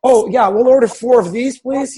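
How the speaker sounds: hammed-up enthusiasm; eager order. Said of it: "It's like".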